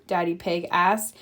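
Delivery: plain reading voice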